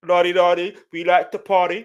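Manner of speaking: lightly voiced British accent from an old rap. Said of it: "He also rapped in a British accent".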